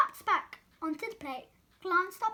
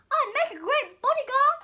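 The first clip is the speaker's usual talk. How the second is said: high, loud, puffed-up boast; boastful; 8 semitones higher, 10 dB louder than usual. Acted. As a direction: puff up and boast loudly. Arnie boasting.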